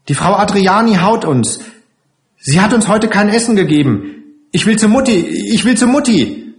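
put-on high, plaintive child's voice; distressed little child. Frightened child begging to go to mother, high plaintive wail. Crying child complains to the rescuers.

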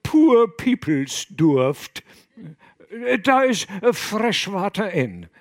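loud, high, slow fisherman dialect; contradicting fishermen. Acted. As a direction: bluster through the lie. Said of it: "widersprachen sich lächerlich".

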